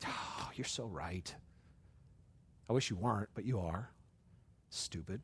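low, soft, reluctant mumble; grudging admission. Acted. Provoked man admits friend is right, low grudging admission. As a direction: concede it quietly under your breath.

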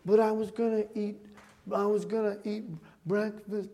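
put-on soft, slightly whiny stalling kid; small mopey kid voice, softly; mopey child. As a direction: imitate a sleepy boy protesting quietly, trailing away. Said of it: "he's kinda mopey in the morning".

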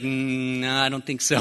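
lightly voiced doubtful old lady; sceptical old lady. Old lady doubting Rob is staff, lightly imitated scepticism. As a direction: imitate an elderly woman politely refusing to believe it. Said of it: "And she was like".

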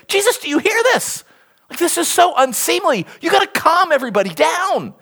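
high, scandalized complaint of the leaders; mock indignation. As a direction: imitate freaked-out officials, voice pushed high. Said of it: "They're indignant, it says".

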